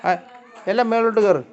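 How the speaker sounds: loud, high command to the cats; real shouted command; calling out orders. Real, not acted.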